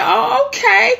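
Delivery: high, surprised oh okay; squeaky mock surprise. Telling viewers about the menu, high surprised oh okay. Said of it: "I said".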